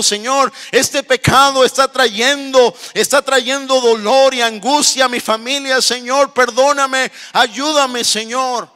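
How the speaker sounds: high, impassioned pleading; preacher voicing a sinner crying out to God; acted anguished prayer. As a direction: beg urgently for help, phrase after phrase. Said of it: "Diciéndole".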